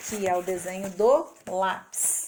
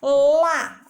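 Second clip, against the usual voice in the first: Lá called out much louder; emphatic demo; 4 semitones higher, 9 dB louder than usual. Shown as a demonstration. Teacher calling out the syllable Lá for the class. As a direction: belt out Lá to mark the syllable. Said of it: "Agora sim a gente fala".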